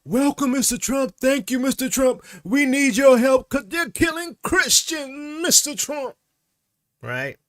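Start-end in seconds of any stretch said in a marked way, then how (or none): 0.0-6.2 high, exaggerated pleading voice; mock grateful supplicants. Africans welcoming Mister Trump and asking for help, mock pleading, high-pitched. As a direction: mimic fawning welcomers in a high put-on voice. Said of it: "some of these Africans are like".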